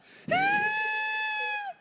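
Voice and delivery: long, very high whine; mock rage squeal. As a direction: hold a comic squeal of pent-up anger. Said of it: "I'm just angry".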